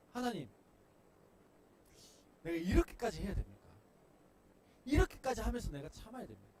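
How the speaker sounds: loud, high plea to God; exasperated shout upward; anguished complaint. Pretend. Believer crying out to God.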